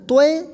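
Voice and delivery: توئے as two sounds, to-ey; wrong toy reading. Shown as a demonstration. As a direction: glide from o to ey on توئے. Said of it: "اس طرح نہیں کہیں گے".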